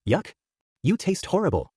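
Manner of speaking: high-pitched, mildly disgusted exclamation. A narrator's reading. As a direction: recoil from the taste, pitch lifted.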